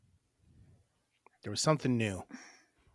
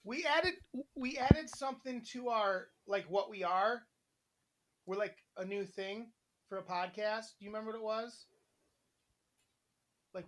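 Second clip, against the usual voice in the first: high sweet voice; 9 semitones higher than usual. Real, not acted. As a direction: coo it sweetly to babe. Talking to partner off-mic.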